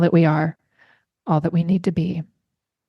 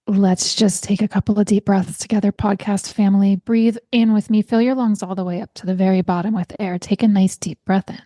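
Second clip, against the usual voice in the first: guided breathing. Acted. Leads a breathing exercise.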